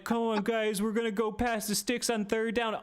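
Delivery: mock his cheesy huddle speech; mocking Russell Wilson. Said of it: "He's by himself being like".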